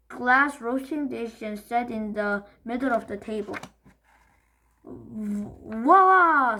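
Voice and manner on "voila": loud, showy announcement; proud voila. Acted. Dad presenting the roast potatoes. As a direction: present it with a flourish. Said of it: "said Dad".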